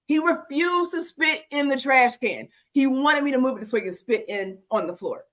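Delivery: usual speaking voice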